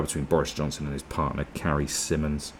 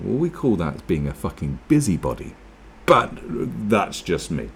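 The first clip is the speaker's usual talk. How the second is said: ordinary talk, nothing marked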